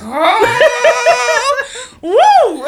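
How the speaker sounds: high, loud teasing whoop; mocking sisters. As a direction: taunt the crying sibling. Sisters mocking crying child.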